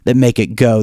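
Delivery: normal talking voice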